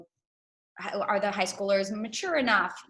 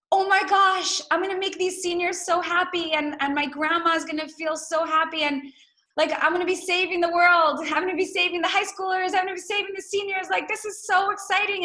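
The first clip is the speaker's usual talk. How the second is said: much higher and louder, gushing with joy; bursting excitement; 9 semitones higher, 4 dB louder than usual. Retold or imitated. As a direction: play the giddy inner voice dreaming of saving the world. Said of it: "so much love and excitement".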